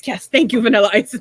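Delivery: loud, grateful imitation of an old man; mock-elated acceptance; excited old man. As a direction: blurt out delighted thanks, loudly. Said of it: "he was so excited to see him".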